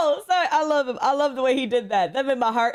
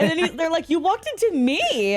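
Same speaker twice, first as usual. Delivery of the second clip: laughing while speaking; 7 semitones higher than usual. Retold or imitated.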